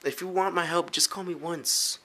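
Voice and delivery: ordinary delivery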